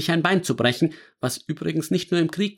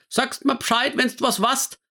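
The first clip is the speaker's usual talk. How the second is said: calling into the phone; 11 semitones higher than usual. Acted. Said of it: "rief sie noch in den Hörer".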